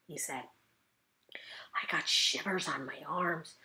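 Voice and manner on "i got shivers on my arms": slow frightened whisper; hushed shivery whisper. A narrator's reading. Junie B tells the listener she got shivers, in a whisper. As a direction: breathe it out like a scared secret.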